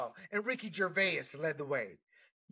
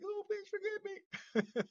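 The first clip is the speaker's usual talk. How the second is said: very high, drawn-out begging; hammed-up falsetto plea for forgiveness; mock pleading Eve; 3 dB quieter, slower than usual. Voices Eve begging his mother.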